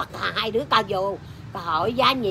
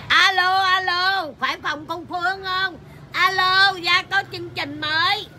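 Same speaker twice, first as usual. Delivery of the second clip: imitated caller; 8 semitones higher than usual. Caller asking for Phương's room, high imitated hail. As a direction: mimic someone calling out, pitched well up. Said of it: "vừa nghe kêu alo phòng bà Chất".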